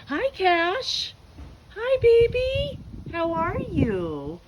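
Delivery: high, sing-song voice for the dog; greeting the dog Cash in high pet-talk. Real, not acted.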